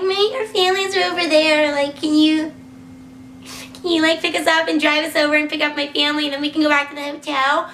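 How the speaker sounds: high rambling ask; retold naive request. Retold request to taxi drivers.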